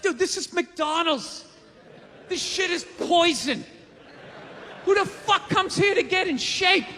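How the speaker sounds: incredulous, building to a shout; exasperated rant. Retold or imitated. Comedian ranting at complainers that McDonald's is poison. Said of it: "It's like".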